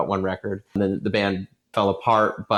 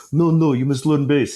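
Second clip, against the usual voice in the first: crappy Arabic accent imitation; dad's bad Arabic accent; 4 semitones higher, 3 dB louder than usual. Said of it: "my really crappy, you know, Arabic accent".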